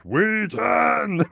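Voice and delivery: hammed-up vengeful bellow; Wrath of Khan shout. Sheldon realises he was tricked, vengeful shout. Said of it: "he comes with the Wrath of Khan fashion".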